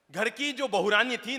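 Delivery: ordinary delivery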